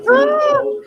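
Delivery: usual speaking voice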